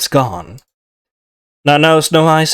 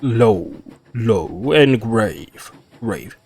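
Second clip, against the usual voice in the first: practising low voice; 4 semitones lower, 3 dB quieter, much slower than usual. Shown as a demonstration. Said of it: "How do you do low".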